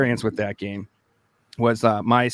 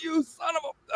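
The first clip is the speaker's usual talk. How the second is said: mock outrage; 6 dB quieter than usual. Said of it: "I'm like".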